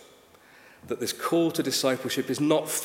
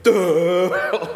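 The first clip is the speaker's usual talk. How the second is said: loud, higher mocking "Duh"; scornful teenager; 4 semitones higher, 7 dB louder than usual. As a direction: scoff like it's ridiculous. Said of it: "as if this was the most ridiculous thing".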